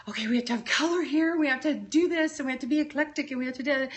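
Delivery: a hint of a bossy decorator rattling off rules; pushy decorator. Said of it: "I'm not like".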